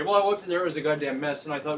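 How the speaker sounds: ordinary talk, nothing marked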